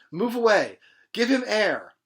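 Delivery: high, loud commands to the crowd; urgent shout. Acted. Crowd shouts over fallen man.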